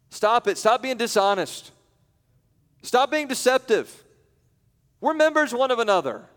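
preaching at full force. Acted. Preacher calling his church to stop lying. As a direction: hammer each command home from the pulpit.

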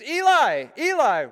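imitated little boy yelling for his brother; high, loud, insistent calling; Travis. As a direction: holler the name like a five-year-old. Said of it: "And Travis says".

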